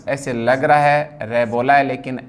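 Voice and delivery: ordinary talk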